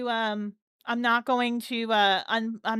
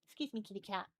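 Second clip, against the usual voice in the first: much softer, off to the side; soft aside; 15 dB quieter, much faster than usual. Real, not acted. Streamer moving the cat aside, soft aside. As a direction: talk quietly to a pet.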